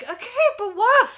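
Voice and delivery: high, loud, laughing protest; amused exasperated pleading; baffled reply. Retold or imitated. Retelling own baffled reply.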